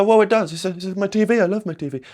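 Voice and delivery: high, indignant mock protest; objecting viewer. Viewer protesting to the coach about his beloved TV, mock indignant. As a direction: whine like someone defending their TV. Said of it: "And you go".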